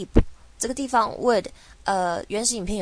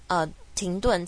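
ordinary talk

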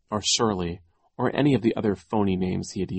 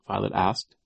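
ordinary delivery, nothing marked

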